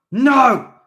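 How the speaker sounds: very loud high snarl. A narrator's reading. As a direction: spit the refusal. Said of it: "Steve snarled".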